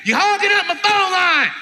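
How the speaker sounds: high, loud yell from another room; imitated parent shouting to get off the line. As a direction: holler it like an annoyed parent. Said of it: "Mom and dad yelling from the back".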